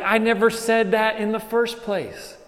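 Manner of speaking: lifted high as God setting the record straight; God correcting. Acted. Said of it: "but God's like".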